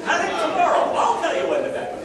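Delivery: mock health director reassuring the public. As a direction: parody a pompous health director. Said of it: "there have been health directors".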